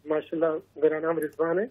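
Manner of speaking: real soft, low-volume speech; too quiet. Real, not acted. Caller greeting the show's hosts, too quiet to hear well. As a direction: introduce yourself barely audibly. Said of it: "آواز کم آ رہی ہے بہت".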